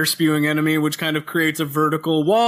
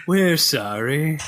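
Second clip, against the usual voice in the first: South Park apology voice; much slower than usual. Retold or imitated. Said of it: "that scene from South Park where they have the guy who's apologizing".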